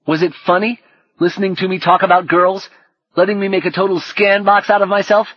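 high, rising, hurt tirade; something like rage. Acted. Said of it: "something like rage".

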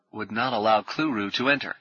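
ordinary delivery

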